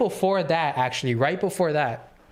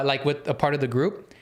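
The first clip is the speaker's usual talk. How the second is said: ordinary delivery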